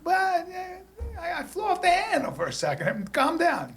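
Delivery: high sheepish plea; mock sheepish. Husband coaxing his angry wife, high and sheepish. Soothing wife after blowup.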